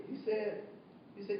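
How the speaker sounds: ordinary talk, nothing marked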